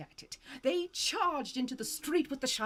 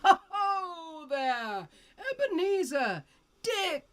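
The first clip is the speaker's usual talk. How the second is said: jovial fat voice; 8 semitones higher, slower than usual. A narrator's reading. Fezziwig calls his apprentices, rich jovial call. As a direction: boom it out like a fat, merry old master. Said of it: "comfortable, oily, rich, fat, jovial voice".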